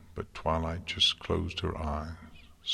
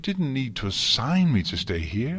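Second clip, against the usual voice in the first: higher-pitched, hurt protest; upset protest; 10 semitones higher, 4 dB louder than usual. A narrator's reading. Twilight confronting her mentor about being assigned away, upset. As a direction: plead it in a raised, wounded pitch.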